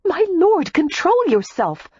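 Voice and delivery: high-pitched, flustered protest; indignant yelp of a reprimand; alarmed. A narrator's reading. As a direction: fend him off, voice shooting up. Alarmed woman fends off suitor.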